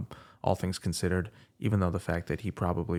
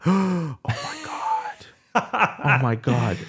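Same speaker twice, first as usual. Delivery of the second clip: slow, much higher hammed-up oh my God; mock shock; 8 semitones higher, much slower than usual. Mocking the intended audience reaction to the crash, slow hammed-up shock. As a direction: ham up being shocked, drawn out slowly. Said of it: "you're supposed to be like".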